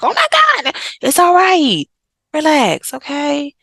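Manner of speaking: mock the parent who says relax; exasperated parent. Parent telling a grown child to relax, mocking exasperation. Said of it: "I hear some of y'all be like".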